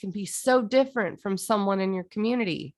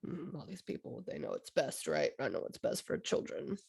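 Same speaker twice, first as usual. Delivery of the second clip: much softer, whispery sneering aside; mocking know-it-alls; 6 semitones lower, 12 dB quieter than usual. Mocking people who claim to know best, soft sneering whisper. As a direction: breathe it out like a smug secret.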